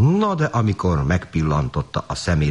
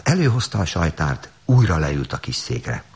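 plain reading voice

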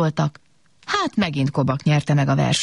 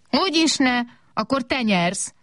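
snubbing retort; 8 semitones higher than usual. A narrator's reading. Small robot rejecting the big one's game, snubbing. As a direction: cut the idea down in a high voice. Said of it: "torkolta le a kis robot".

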